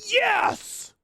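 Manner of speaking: high, delighted yes; excited cheer. Real, not acted. Cheering as Bruce's raid comes in, high and delighted.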